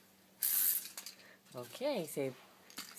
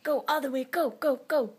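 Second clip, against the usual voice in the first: high, loud cheer; 9 semitones higher, 9 dB louder than usual. Real, not acted. Cheering toddler's somersault.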